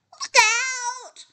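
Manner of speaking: drawn-out storybook call of alarm; warning call. A narrator's reading. Littlest rabbit warns the racers, drawn-out call. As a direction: call a warning, stretching the last word. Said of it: "he called".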